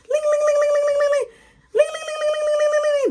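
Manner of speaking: loud, high continuous ringing; piercing mock bell noise; imitated bell. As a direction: trill relentlessly like an alarm bell. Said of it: "That's all you hear".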